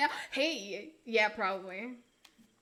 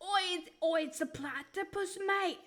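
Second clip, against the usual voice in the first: put-on Australian accent; 2 semitones higher than usual. Streamer warning of the stinger, mock Australian accent. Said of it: "I love Australians' accents".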